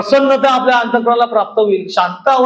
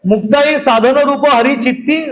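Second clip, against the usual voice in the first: slow, measured abhang recital; devotional verse recitation; slower than usual. Acted. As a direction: recite the verse slowly, drawing out each phrase.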